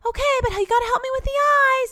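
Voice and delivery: put-on high-pitched little boy voice; put-on high kid voice; little son. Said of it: "his son keeps being like".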